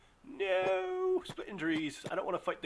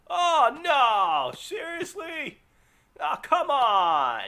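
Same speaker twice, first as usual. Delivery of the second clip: loud, high, slow frustrated outcry; real exasperation, stretched out; exasperated shout; 14 semitones higher, 12 dB louder, much slower than usual. Real, not acted. Gamer seeing more spiders arrive, exasperated shouting.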